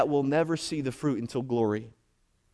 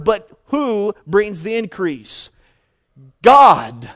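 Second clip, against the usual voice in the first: loud, raised preaching voice; emphatic preaching; 4 semitones higher, 7 dB louder than usual. Real, not acted. Preacher quoting Paul to the congregation, emphatic preaching. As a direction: build the question, then boom out the answer.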